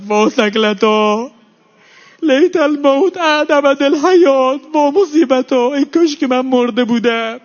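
high, loud wailing cry of a bereaved sister; grieving lament. Acted. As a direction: wail it as a mourning sister. Zeinab's lament voiced by preacher. (ناله و شیون سوزناک خواهر داغدار)

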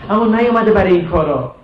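normal talking voice

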